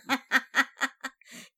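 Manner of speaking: teasing burst of laughter. Real, not acted. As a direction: laugh before the tease. Laughs at grudge joke.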